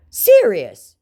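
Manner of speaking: high, loud mock scammer. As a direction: bark it as the scammer. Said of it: "he said".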